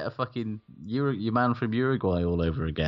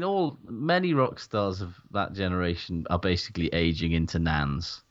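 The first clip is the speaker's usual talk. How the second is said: normal talking voice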